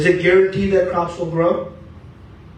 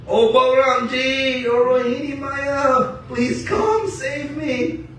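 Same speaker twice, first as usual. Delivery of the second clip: put-on high, slow pleading wail of a little boy; child Krishna crying out; 10 semitones higher, slower than usual. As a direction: call for help, stretched and high. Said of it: "Krishna is yelling".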